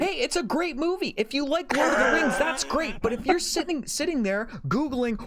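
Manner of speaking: high, worked-up rant; ranting, pitch way up; getting heated. Real, not acted. A podcaster ranting at Oscar chasers, high and heated. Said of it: "I'm getting heated".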